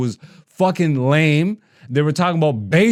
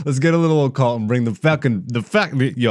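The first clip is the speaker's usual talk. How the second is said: usual speaking voice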